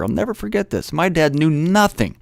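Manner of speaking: normal talking voice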